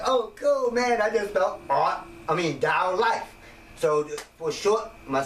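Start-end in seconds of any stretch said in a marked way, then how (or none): 0.0-3.3 pleased discovery. Retold or imitated. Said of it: "And I was like".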